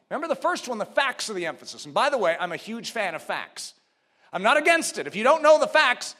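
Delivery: ordinary talk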